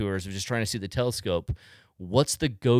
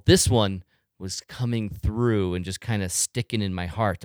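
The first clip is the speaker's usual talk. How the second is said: ordinary talk, nothing marked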